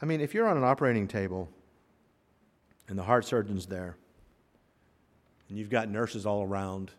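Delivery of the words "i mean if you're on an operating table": normal talking voice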